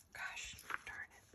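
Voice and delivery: whispered, annoyed under breath; whispered frustration. Real, not acted. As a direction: hiss it under your breath. Frustrated muttered aside.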